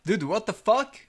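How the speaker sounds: loud, high disbelief; shocked. Real, not acted. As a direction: blurt it out in shock. Shocked by another server's maps.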